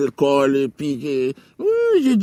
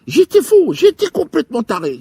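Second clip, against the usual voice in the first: manic burst; 7 semitones higher, 5 dB louder than usual. Acted. Manic confession, loud burst.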